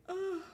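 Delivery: high-pitched groan of frustration; exasperated ugh. Real, not acted. Frustrated while searching for news.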